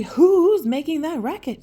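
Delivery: high, loud owl screech. Acted. Woken owl screeching at the noise, high and loud. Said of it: "screeched the owl".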